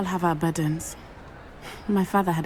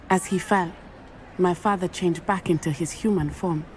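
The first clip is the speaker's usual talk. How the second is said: ordinary talk, nothing marked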